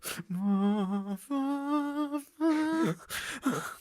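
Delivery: very high put-on cackle; high mock laugh. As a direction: let out the clown's trademark laugh. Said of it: "he's like".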